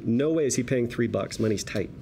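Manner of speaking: usual speaking voice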